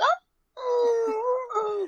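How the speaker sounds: long, high mock wail; imitated spinning cry. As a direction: wail high like a cartoon skater whirling round. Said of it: "skating and spinning around".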